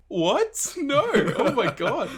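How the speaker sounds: high-pitched mock disbelief; astonished reaction. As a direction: re-enact a stunned, excited outburst. Acting out her delighted movie reaction.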